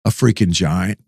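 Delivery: low-pitched deadpan imitation; laid-back mock understatement; chill. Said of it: "he's so chill".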